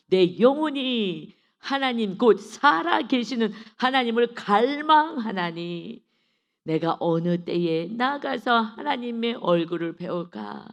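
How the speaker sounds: loud, drawn-out declamatory reading; scripture recitation. Acted. Preacher reciting a psalm to the congregation, declamatory. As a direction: stretch out the psalm with fervent force.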